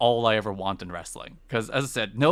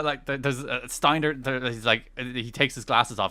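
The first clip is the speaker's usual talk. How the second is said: ordinary delivery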